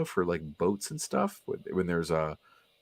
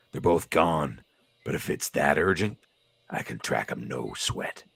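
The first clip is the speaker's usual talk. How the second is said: low-pitched, offhand tough-guy voice; laconic tough guy; 6 semitones lower, 4 dB quieter than usual. Acted. Tracker telling the professor it's no sweat, low and laconic. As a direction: drop low and toss it off casually. Voices gruff teammate Wolverine.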